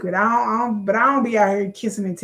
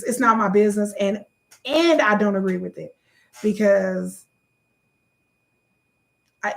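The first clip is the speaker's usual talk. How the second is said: usual speaking voice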